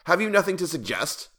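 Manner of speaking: high-pitched demanding question; impatient king. A narrator's reading. King demanding a suggestion from the jester, impatient. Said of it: "said the king impatiently".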